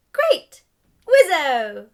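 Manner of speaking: excited cheer. Acted. Delphi thrilled that Albus calls them friends, excited cheer. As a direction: squeal it out with glee.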